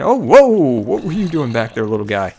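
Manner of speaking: loud startled whoa. Real, not acted. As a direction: jump at the surprise, then call out. Startled by mob.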